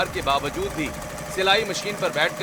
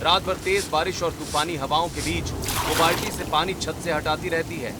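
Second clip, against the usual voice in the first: story narration. A narrator's reading. Narrator describing the sister's night on the roof, storytelling. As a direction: narrate the scene calmly.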